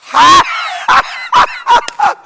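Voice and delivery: huge, very high, loud laugh; preacher showing the congregation how to laugh; big belly laugh. Shown as a demonstration. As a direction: laugh out fully, way over the top. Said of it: "이렇게 웃으세요".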